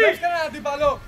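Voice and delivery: loud shouted correction; shouting. Real, not acted. Coach correcting a player's marking, shouting. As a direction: shout it at a player across the pitch.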